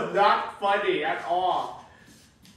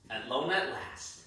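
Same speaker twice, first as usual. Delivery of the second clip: relieved sigh; 8 semitones lower, 7 dB quieter than usual. Acted. Character relieved to be alone.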